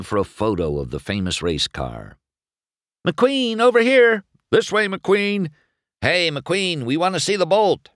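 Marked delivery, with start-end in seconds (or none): 3.1-7.9 high, urgent calling out; clamouring calls. A narrator's reading. Reporters angling for a photo, calling out. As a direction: compete for the star's attention.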